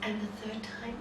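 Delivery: soft, gentle reproach; voice of God. Acted. God answering the man in his temple, soft reproach. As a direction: speak softly as a wronged father. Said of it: "he heard the voice say".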